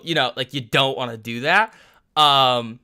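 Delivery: ordinary talk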